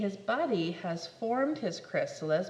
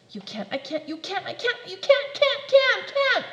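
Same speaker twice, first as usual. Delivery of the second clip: rising higher and louder; building panic; 9 semitones higher, 7 dB louder than usual. Acted. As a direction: spiral into frenzy.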